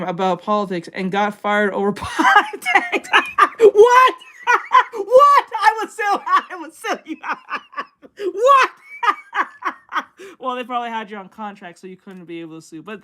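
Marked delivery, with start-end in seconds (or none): 3.6-4.2 high, loud laughing "what"; stunned squeal of a question; shocked laughing shout. Real, not acted. Shocked at friend's firing story. 4.9-8.3 high, loud laughing "what"; stunned squeal of a question; shocked laughing shout. Real, not acted. Shocked at friend's firing story. 8.3-10.4 stunned squeal of a question; shocked laughing shout. Real, not acted. Shocked at friend's firing story.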